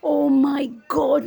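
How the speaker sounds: shocked gasp. Retold or imitated. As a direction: stretch it out in shock. Reacting to haunted door. (حیرت سے کھنچا ہوا)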